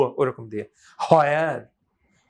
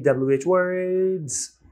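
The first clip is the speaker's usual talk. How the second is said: stretched, higher-pitched sing-out of the term; 4 semitones higher than usual. Shown as a demonstration. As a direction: draw the words out long and high. Teacher stretches the lesson's topic.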